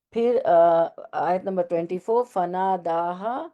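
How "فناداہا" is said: slow syllables. Shown as a demonstration. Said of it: "اب دیکھیں ہا".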